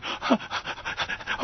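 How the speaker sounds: voiceless excited gasp; mock gasp. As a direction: gasp as if thrilled.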